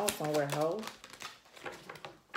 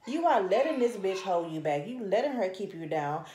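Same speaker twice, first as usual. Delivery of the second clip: ordinary talk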